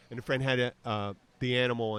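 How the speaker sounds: ordinary talk, nothing marked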